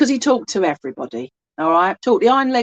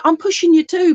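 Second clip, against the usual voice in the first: lively spirit voice; 8 semitones higher, 5 dB louder than usual. Retold or imitated. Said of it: "And he's like".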